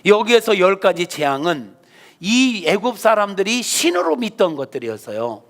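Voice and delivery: normal talking voice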